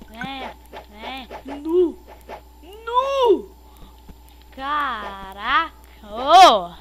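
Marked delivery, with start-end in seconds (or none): 1.6-6.7 amazed. Real, not acted. Amazed at game event.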